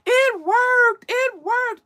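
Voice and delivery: high, loud, jubilant cries; elated shouting. Retold or imitated. Manager tells Vanessa the remedy worked, elated shouting. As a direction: shout it out as the thrilled friend.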